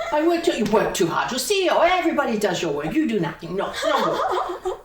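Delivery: loud, high, scolding Italian grandma; Italian nonna. Acted. Nonna telling grandson he works too hard, loud Italian nonna. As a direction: berate him as a hammed-up nonna. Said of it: "I'm doing my Italian nonna".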